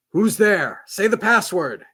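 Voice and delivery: loud, high guard's challenge. Acted. Guard shouting at an approaching stranger, loud challenge. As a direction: bark the challenge into the dark.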